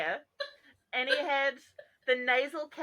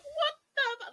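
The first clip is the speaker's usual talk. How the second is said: much higher, cracking disbelief; shocked outburst. Retold or imitated. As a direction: yelp of horror at the screen. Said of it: "and I'm like".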